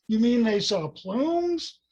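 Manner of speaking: high, drawn-out disbelieving question; retold astonished blurt. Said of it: "was he blurted".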